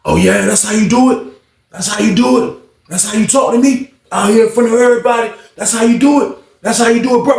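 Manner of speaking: high-pitched repeated questioning; hammed-up wounded disbelief; acted indignant accusation. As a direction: confront a friend who showed you up.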